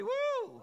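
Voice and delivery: very high, loud whooping woo; high woo shout. Shown as a demonstration. Preacher leads congregation in a high loud woo. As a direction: let out a joyful whoop. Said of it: "somebody say".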